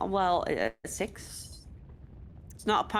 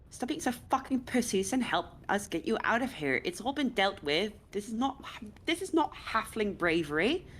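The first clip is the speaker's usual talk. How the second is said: really frustrated; 8 semitones higher than usual. Acted. Pipkin scolds cowering halflings to come help, frustrated and high. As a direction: scold them into moving, pitch climbing. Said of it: "Like, really frustrated".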